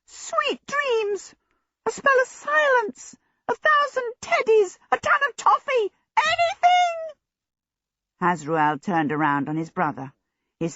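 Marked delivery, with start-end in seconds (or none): 0.0-7.0 frantic, very high shrill pleading; small djinn shrilling out suggestions, desperate; trumpeting djinn. A narrator's reading. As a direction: trumpet each idea, rising to a final cry. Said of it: "trumpeted the other djinn".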